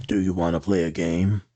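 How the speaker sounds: low, eerie horror-villain voice; low creepy horror villain taunt; Jigsaw voice. Retold or imitated. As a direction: ask it like the Jigsaw puppet. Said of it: "you got your Scream mask on".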